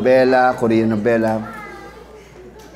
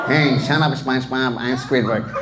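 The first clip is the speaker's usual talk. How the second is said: low, softer grumpy Squidward; 3 semitones lower, 4 dB quieter than usual. Shown as a demonstration. Voice actor doing Squidward, low and soft. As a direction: grumble it like Squidward. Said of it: "Lahat ng karakter sa SpongeBob".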